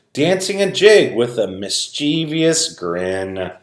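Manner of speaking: usual speaking voice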